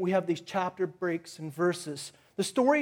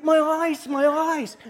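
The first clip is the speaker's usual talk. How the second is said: higher, louder cry of pain over burning eyes; 8 semitones higher, 5 dB louder, slower than usual. Retold or imitated. Retelling the jalapeno juice in his eyes, a loud pained cry. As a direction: wail it like your eyes are on fire. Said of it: "I'm like".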